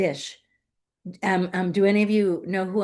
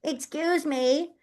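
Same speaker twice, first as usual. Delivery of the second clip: high, indignant little seed voice; mock-offended high voice, rising as it goes; impatient avocado seed; 8 semitones higher than usual. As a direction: whine it like a seed demanding its report card. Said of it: "imagine the avocado seed is thinking".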